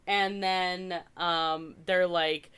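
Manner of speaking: ordinary delivery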